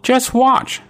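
tiny high-pitched ant voice; little ant voice. A narrator's reading. Little ant promising the farmers, in a tiny high voice. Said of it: "said ant".

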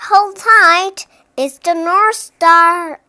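high, loud storybook shout; shouted warning. A narrator's reading. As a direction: shout it out over the wind. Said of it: "Albatross shouted".

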